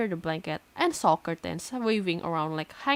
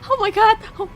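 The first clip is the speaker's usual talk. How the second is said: real shriek, much higher and louder; terrified scream; 16 dB louder than usual. Real, not acted. Reader shrieking in real shock as the door swings open.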